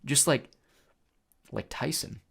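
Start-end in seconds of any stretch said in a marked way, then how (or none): none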